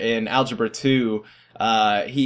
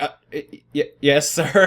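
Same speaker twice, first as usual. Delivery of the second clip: nervous stammer; 3 semitones higher than usual. Retold or imitated. Student answering the teacher's warning, nervous stammer. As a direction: stammer out a meek reply, half laughing.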